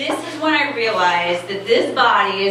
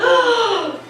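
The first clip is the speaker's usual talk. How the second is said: startled gasp; 12 semitones higher than usual. Retold or imitated. Sudden realization watching TV.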